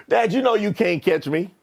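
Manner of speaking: ordinary delivery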